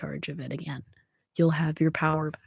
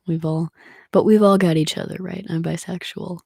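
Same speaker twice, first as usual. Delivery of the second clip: normal talking voice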